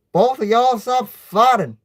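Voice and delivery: high, loud scolding order; strong voice. A narrator's reading. Uncle breaking up siblings' fighting, strong voice. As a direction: raise the voice and put a stop to it. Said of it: "talks in a strong voice".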